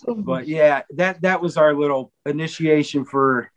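normal talking voice

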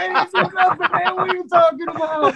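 hammed-up panicked pleading; imitated panic. Ed panicking, denying he eyed the woman. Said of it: "he's in pure panic mode".

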